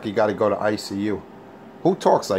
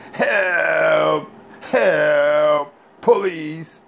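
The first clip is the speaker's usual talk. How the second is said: mock crying for help; 5 semitones higher, much slower than usual. Mocking a grown man crying after a handshake. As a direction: wail help like a crushed wimp. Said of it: "you start crying".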